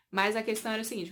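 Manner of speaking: normal talking voice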